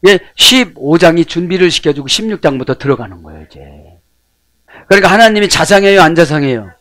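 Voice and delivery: ordinary talk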